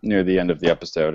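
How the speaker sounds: ordinary talk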